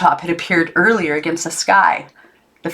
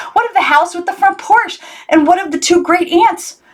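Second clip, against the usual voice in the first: higher, anxious questioning; rising panic in her thoughts; girl's hopes collapsing, much higher; dismayed; 12 semitones higher than usual. A narrator's reading. Maggie's dismay at the building.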